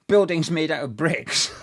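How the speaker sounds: laughing through the words; can't hold back a bitter laugh. Real, not acted. Mocking the board's plans, laughing as he speaks. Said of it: "don't know why I'm laughing".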